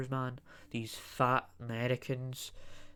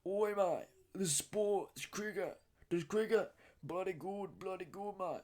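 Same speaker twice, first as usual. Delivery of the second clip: higher, softer mock Aussie voice; Australian accent; 6 semitones higher, 7 dB quieter than usual. Vlogger mocking Australian cricket fans, in a mock Aussie accent. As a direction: do a cheeky Aussie mate. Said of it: "these Australians act like this".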